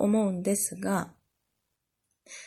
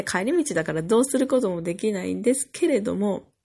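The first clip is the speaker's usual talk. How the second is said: ordinary talk, nothing marked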